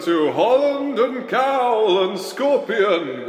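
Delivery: slow, drawn-out show opener; announcer intro. Acted. Host welcoming listeners to the show, slow announcer read.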